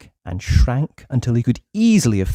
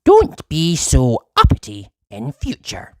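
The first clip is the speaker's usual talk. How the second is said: high, louder squeaky warning; goblin warning; 3 semitones higher, 4 dB louder, slower than usual. Acted. Goblin warning Uppity, high and squeaky. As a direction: wag a finger as a little goblin.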